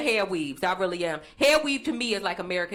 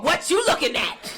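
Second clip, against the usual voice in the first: higher, louder threatening cheer chant; tough cheer; 6 semitones higher, 5 dB louder than usual. Retold or imitated. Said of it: "They had a cheer called".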